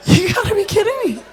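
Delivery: high, laughing disbelief; laughing, incredulous you gotta be kidding me; mock shock. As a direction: squeal it like you can't believe your eyes. Reacting to rude driver.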